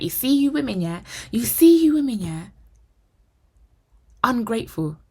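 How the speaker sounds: high, indignant man's rant; mock outrage, pitched high; retold angry rant. Retelling his outburst before storming out.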